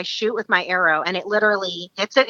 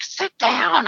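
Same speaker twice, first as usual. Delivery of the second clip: urgent command; 6 semitones higher than usual. Retold or imitated. Ordering her down during stalk.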